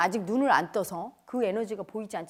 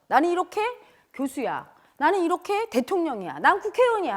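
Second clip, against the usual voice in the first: louder, higher self-important boast; puffed-up ego; 5 semitones higher, 4 dB louder than usual. Acted. Ego boasting of titles and rank, mock self-importance. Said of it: "이렇게 자기 에고의 어떤".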